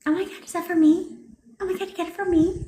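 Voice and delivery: put-on high five-year-old voice. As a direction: imitate a childlike, eager little question. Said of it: "like a five-year-old".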